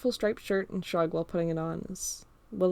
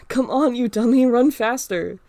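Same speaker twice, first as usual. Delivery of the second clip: loud, higher, playful urging; teasing shout; 4 semitones higher, 10 dB louder, slower than usual. Acted. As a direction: yell back at the slow friend. Running, urging friend on.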